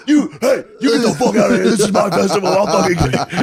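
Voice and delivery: hammed-up host losing it at a heckler; mock furious outburst. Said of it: "Lewis is like".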